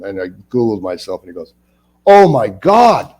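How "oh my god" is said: loud, high, drawn-out exclamation; stretched-out mock astonishment; acted amazement. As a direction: imitate a starstruck fan, slow and loud. Kid recognizing the actor, retold.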